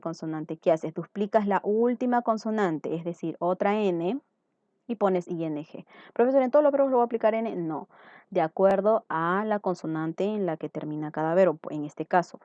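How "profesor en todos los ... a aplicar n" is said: lightly voiced student, higher, louder and quick; imitating a student. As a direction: rush out a pupil's question, pitched up. Teacher voices a student's question. (alumno que pregunta, imitado con voz más aguda)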